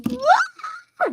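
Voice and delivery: very high screamed "what"; sudden high-pitched mock scream; retold shriek. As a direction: shriek it in shock, imitating her. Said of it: "And she starts screaming".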